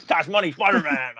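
laughing, half-hearted gruff editor voice; a touch of the shouting newspaper boss; J K Simmons impression. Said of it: "your J K Simmons impressions".

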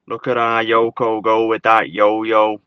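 loud mock Scouse singsong; Scouse phrase. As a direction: belt it out like a Liverpool local. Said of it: "say stuff like".

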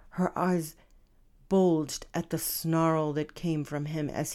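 careful, measured story narration; formal reading. Acted. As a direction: read each word precisely. Author reads romance chapter aloud.

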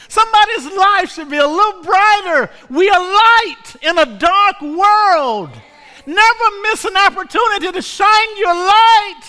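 high, shouted sermon climax; full-force preaching. Acted. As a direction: whoop and cry it out to the congregation.

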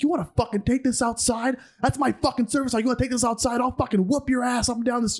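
high-pitched mock angry threats; retold flipped-out rant. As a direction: imitate an irate man spoiling for a fight. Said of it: "the guy just fucking flipped out".

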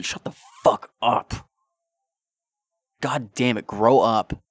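harsh, loud outburst at petty fans; real anger. Real, not acted. Ranting at fans who hate on others, angry loud outburst. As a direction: snap at them to grow up. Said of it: "it makes me so goddamn angry".

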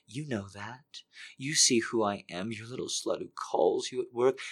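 soft, breathy, pleading whisper-voice; soft desperate begging. Acted. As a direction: plead softly, hungry and desperate. Character begs in hushed voice.

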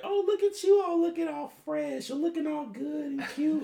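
high, airy mock flattering woman; imitating stripper. Mocking the stripper's flattery, high girly voice. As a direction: gush at him in a high put-on girly voice. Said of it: "she was like".

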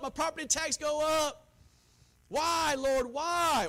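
hammed-up frustrated questioning; mock complaining. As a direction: complain to God in an exaggerated plea. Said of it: "Not co-, to complain".

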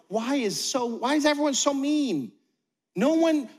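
self-pitying newcomer whining that everyone is mean; whiny complainer. Retold or imitated. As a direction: complain like nobody likes you. Churchgoer whining about unfriendly church.

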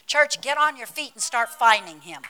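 loud, forceful preacher's charge; rousing call. Real, not acted. Preacher calling church to its feet, loud and rousing. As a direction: call the whole congregation to rise.

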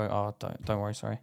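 soft, low, backing down; retold sheepish apology, dropped low and quiet; apologetic. Driver apologising to someone he thinks he could take on, sheepishly. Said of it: "I feel bad and go".

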